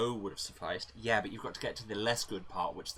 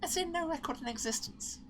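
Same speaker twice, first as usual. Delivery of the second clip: much higher, upbeat character voice; Roxy voice; 4 dB quieter than usual. Acted. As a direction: sell it high and chirpy. Roxy explains the missing record.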